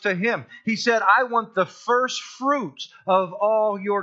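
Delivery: loud, driving sermon delivery; ringing pulpit voice, building on each line; preaching at full force. Real, not acted. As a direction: press the point hard to the congregation.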